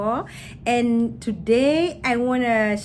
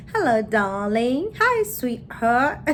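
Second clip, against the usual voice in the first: slow, drawn-out mock British greeting; British accent; slower than usual. Showing how they talk in UK, mock British accent. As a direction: imitate a British endearment, stretching the words. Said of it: "with the British accent".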